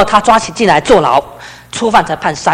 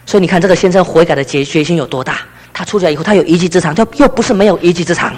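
normal talking voice

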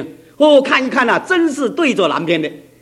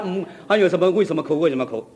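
ordinary talk, nothing marked

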